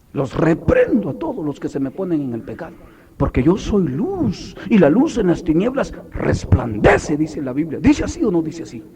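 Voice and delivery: high, swelling preacher's cry with stretched key words; impassioned sermon delivery; forceful preaching. Real, not acted. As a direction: preach it with rising fervor.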